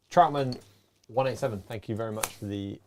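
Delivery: ordinary talk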